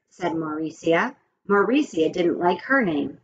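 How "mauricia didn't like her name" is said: ordinary delivery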